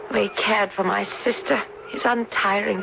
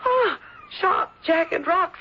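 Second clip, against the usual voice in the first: slow, crying words; tearful; 8 semitones higher, much slower than usual. Acted. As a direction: near tears, dragging each word out. Shaken girl recounting near fall.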